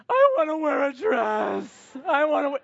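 put-on high shouted toddler insistence; insistent toddler. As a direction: whine it loudly like a stubborn two-year-old. Toddler demanding a dress.

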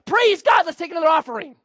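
high, loud hammed-up praise; preacher mocking a faith healer, excited cry; mock healer exclaiming. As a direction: ham up the excited preacher cashing in. Healer exclaims after leg grows.

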